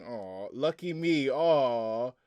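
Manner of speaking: ordinary talk, nothing marked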